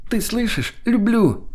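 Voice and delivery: very high-pitched, elated cry; giddy exclamation. Acted. Lena insisting to her lover she loves him, high and excited. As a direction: make sure he hears the declaration. Giddy shouted love confession continues.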